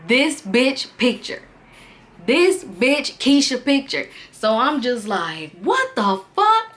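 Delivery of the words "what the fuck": high, stunned cry of disbelief; the shock of finding her photos; shocked outburst. Retold or imitated. Yelling in shock at the photos in the album.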